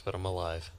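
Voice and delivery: ordinary delivery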